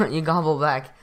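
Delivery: normal talking voice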